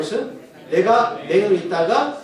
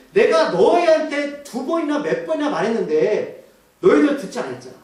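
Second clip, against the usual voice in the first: frustrated; 4 semitones higher than usual. Acted. Blind man to Pharisees who won't listen, exasperated. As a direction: push the complaint out high, then let it drop. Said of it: "답답하게 뭐라고 하냐면".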